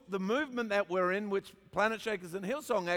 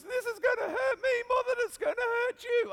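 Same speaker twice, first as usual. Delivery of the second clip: very high, squeaky wail; imitating a weeping dad about to punish; mock crying father. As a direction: whimper it in a hammed-up tearful falsetto. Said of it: "he starts crying and goes".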